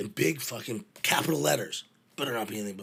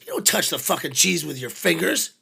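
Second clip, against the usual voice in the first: loud, higher mock rant; roadie scolding; 6 semitones higher, 8 dB louder than usual. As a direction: chew him out over the cheese. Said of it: "He goes".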